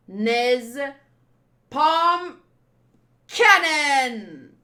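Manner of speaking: loud battle call; attack shout. Acted. Fighter calling his attack, a loud battle shout. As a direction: bark out each word of the attack.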